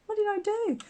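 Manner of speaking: high-pitched, baffled self-questioning; puzzled wonder. Acted. Crafter imagining viewers puzzling over their old backgrounds, puzzled. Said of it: "and you'll think".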